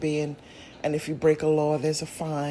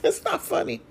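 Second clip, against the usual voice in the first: high, giggling denial; laughing; 9 semitones higher than usual. Real, not acted. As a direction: say it through laughter. Denies the joke while laughing.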